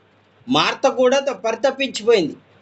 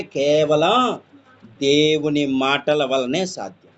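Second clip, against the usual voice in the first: slow, weighty emphasis; 6 semitones lower, slower than usual. Real, not acted. Preacher to congregation, slow and low. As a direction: drag each word out, lower. Preacher drives home the point.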